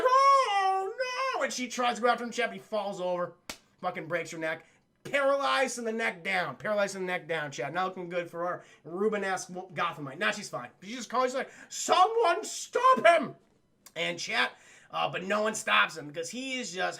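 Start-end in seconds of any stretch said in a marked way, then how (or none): 0.0-1.4 high, loud, drawn-out wail; hammed-up damsel crying out; mock dismay. Retold robbery victim's cry. 11.7-13.3 high, loud, slow wail; hammed-up victim calling out; mock cry for help.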